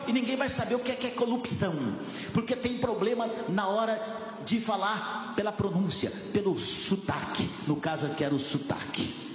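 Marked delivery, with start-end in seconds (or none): none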